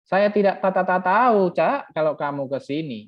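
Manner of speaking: loud, high stuttered Madurese accent; hammed-up Madurese, repeating the first syllable emphatically. Shown as a demonstration. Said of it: "Itu Madura". (logat Madura yang lantang dan tersendat)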